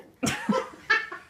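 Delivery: high, loud burst of real laughter; real laughing fit; helpless laugh. Real, not acted. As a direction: crack up completely. Teller cracks up.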